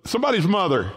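usual speaking voice

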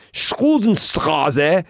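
loud, high, stretched German street name; German-speaking uncle. Retold or imitated. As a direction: imitate a German uncle dictating an address. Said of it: "He goes".

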